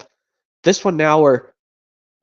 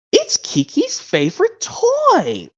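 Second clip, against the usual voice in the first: cartoon dragon voices; much slower than usual. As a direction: coo peekaboo like a playful cartoon dragon. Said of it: "that goes like this".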